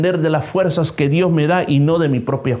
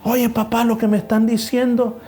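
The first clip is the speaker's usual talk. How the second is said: put-on amazed child; 8 semitones higher, faster than usual. Child amazed, telling father fast.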